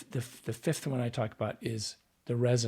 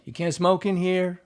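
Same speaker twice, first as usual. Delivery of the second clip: louder, higher variant; another read; 7 semitones higher, 8 dB louder than usual. Shown as a demonstration. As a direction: push the line harder. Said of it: "depending upon how".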